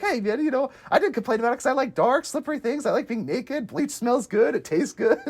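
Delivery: mock Charlie voice. Retelling Charlie's line, acted voice.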